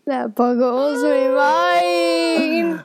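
very slow, drawn-out wail of amazement; hammed-up catchphrase. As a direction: stretch it out in mock astonishment. Performing the segment catchphrase.

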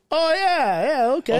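high-pitched imitated realization; mock recognition, pitched well up; sudden recognition. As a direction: act out it suddenly dawning on him. Acts out tattoo artist's sudden recognition.

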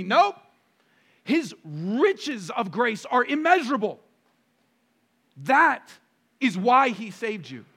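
high, loud, impassioned pulpit delivery; emphatic preacher knocking down each objection; full-force preaching. Acted. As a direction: preach hard, punching each key word.

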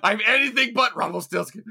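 mock villain boast. Mocking the villain, laughing.

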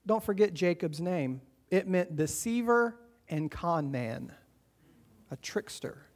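ordinary talk

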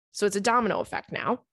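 ordinary talk

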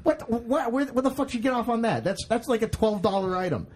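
high-pitched imagined indignant rant; mock outrage. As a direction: play a vase collector losing it at the price. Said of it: "who are just sitting there like".

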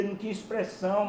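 usual speaking voice

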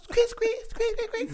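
high squeaking noise; squeaky rubber duck. Acted.

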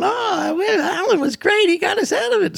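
very high-pitched mock female voices; gushing girls. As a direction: imitate girls gushing praise, voice way up. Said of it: "and the girls are going".

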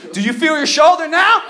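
high, loud mock-earnest question; friend's hollered question. Friend asking after dislocating the pinky, loud and high.

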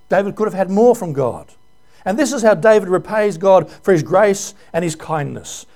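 usual speaking voice

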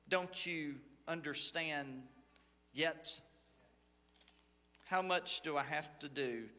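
low, measured Bible reading; scripture reading. Acted. Pastor reading Mark's gospel to the congregation, measured and low. Reads Gospel passage aloud.

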